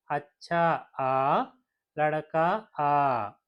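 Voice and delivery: అచ్చా ఆ and లడకా ఆ said very slowly, each final aa drawn out; stretched aa endings. Shown as a demonstration. Teacher slowly drawing out the aa endings for learners. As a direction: drag out the aa at the end of అచ్చా and లడకా. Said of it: "ఆతో end అవుతున్నాయి".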